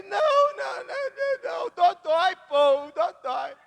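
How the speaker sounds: very high, loud screaming no; crying toddler refusing. Retold or imitated. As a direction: shriek like a terrified little kid.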